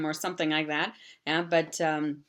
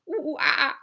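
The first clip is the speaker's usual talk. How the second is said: much higher ooh ooh aah aah; high monkey call; 10 semitones higher, 4 dB louder, faster than usual. Shown as a demonstration. Teacher doing the monkey sound for the book. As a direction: squeak it like a little monkey. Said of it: "is der Affe".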